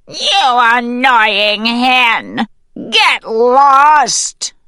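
slow, high, loud snarl; angry weasel. A narrator's reading. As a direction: drive the hen off with a drawn-out yell. Angry weasel drives hen away.

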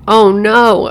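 high, loud shriek of terror. A narrator's reading. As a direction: shriek it in panic. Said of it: "she'd shriek".

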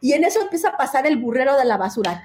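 ordinary talk

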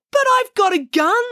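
loud, very high exasperation; protesting bank robber. Acted. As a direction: object in baffled disbelief. Robber protests being sent back.

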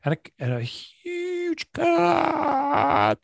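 very high, very slow huge cock; drawn-out joke. Pretend. Joking drawn-out emphasis.

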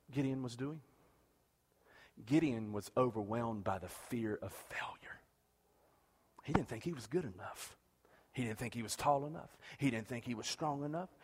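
whispered, soft and low; hushed. Real, not acted. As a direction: lean in and whisper it. Hushed dramatic narration.

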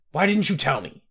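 alarmed. A narrator's reading. King alarmed at the queen's news, demanding. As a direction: snap the question in alarm.

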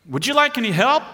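friendly cop hailing from the street; officer calling out. Retold or imitated. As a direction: call out an offer of help from a car.

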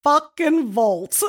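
put-on older sister's voice, slow and scornful. Reading the older sister's text in her voice, slow and scornful. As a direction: drag it out in disgust like a scornful big sister. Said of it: "I'm gonna do it in their voice".

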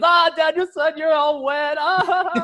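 very high, loud cackling impression; hammed-up laughing tease, drawn out; mock laughing Miyagi. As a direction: crow it through an imitated laugh. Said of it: "And he's laughing".

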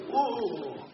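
high, soft mock "whoa"; awed reaction. Bystanders hearing God speak, high soft awe. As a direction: act out hushed amazement.

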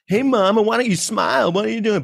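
high mock catcaller; catcalling dude. Dude hitting on a chick, high mocking catcall voice. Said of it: "go to a chick and be like".

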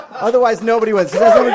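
loud high hype shout; hype host shout. Acted. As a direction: whip up the crowd. Host hyping applause.

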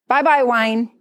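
ordinary talk

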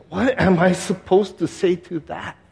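slow, high-pitched inner question; retold overwhelmed thought; helpless disbelief. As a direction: ask it helplessly, pitch jumping on the repeat. Helpless thought after doctor's grim news.

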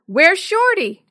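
loud, high shouted question; calling from afar. A narrator's reading. Tevin to the others down the street, calling. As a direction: holler it down the street. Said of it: "Tevin called from down the street".

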